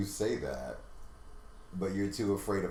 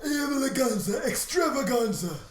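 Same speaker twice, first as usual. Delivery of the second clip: much higher, hammed-up celebrity impression; Joan Rivers; 14 semitones higher, 4 dB louder than usual. Shown as a demonstration. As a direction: screech the title in a high put-on voice. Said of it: "I was giving you Joan Rivers".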